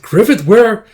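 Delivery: high, loud anxious question; urgent call. Acted. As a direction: call out urgently across a battle.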